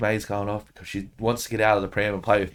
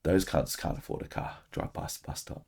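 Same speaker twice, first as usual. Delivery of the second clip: softer, unvoiced conspiratorial whisper; hushed scheming; 4 semitones lower, 9 dB quieter than usual. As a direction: plot it quietly so the kids miss it. Plotting revenge in the car.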